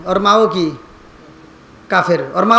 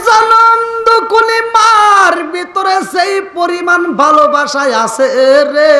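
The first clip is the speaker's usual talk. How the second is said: higher, slow, half-sung shout; rising cry; 7 semitones higher than usual. Acted. Preacher to the crowd, a rising shout. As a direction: call it out to the crowd.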